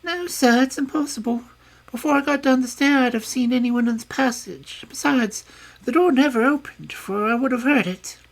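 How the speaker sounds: put-on high, loud servant girl voice; a nervous young maid insisting; frightened maid. A narrator's reading. Maid answering Holmes, high and loud.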